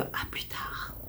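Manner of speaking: unvoiced goodbye whisper. Real, not acted. Vlogger whispering goodbye to her viewers. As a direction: whisper the sign-off. Signing off vlog.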